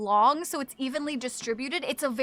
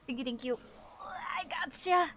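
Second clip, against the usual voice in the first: whispered aside; 3 semitones higher, 7 dB quieter than usual. Real, not acted. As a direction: whisper it through a stretch. Aside while stretching.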